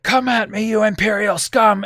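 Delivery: high, loud challenge to the enemy; defiant battle taunt. Acted. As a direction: yell it like a commander daring them. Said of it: "he's like".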